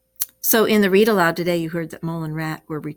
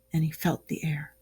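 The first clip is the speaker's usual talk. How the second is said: whisper; 2 semitones lower, 6 dB quieter than usual. A narrator's reading. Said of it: "hushed suspense in narration".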